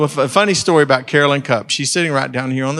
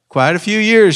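voicing Shirley; 7 semitones higher, 6 dB louder than usual. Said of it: "she says".

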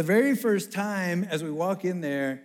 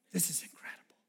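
awed whisper; 12 dB quieter than usual. Retold or imitated. Awed aside after meeting chancellor.

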